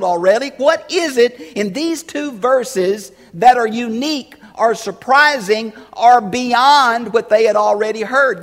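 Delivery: loud, high, driving questions; preaching at full force. Real, not acted. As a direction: press the congregation hard.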